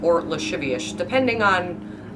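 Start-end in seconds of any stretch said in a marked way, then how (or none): 0.2-0.9 lascivious as luh-SHIV-ee-ish; sh for each s in lascivious; slushy lascivious, luh-shivee-ish; Sean Connery. Shown as a demonstration. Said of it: "I just turned into Sean Connery".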